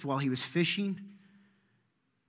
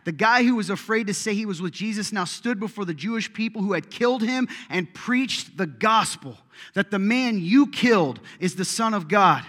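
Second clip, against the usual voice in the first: louder, higher, building preacher cry; rising preaching; 6 semitones higher, 8 dB louder than usual. Acted. Preacher stirring the congregation. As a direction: build it up to a peak.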